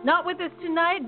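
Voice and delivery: ordinary delivery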